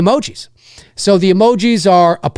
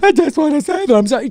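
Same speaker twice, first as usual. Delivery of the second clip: fast, higher, teary parody of an actress; mock weepy apology; 6 semitones higher, faster than usual. Mocking Jamie Lee Curtis's teary award speeches, fake crying. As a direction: rush a fake-sincere sob line. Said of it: "she's like".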